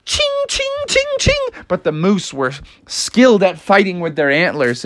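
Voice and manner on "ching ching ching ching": high sword clang noises. Acted. Sword sound effect.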